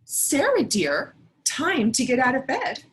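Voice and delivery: put-on sing-song wake-up call to a sleepy child; lightly voiced cheery father; dad waking daughter. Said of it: "Mister Hartwell said".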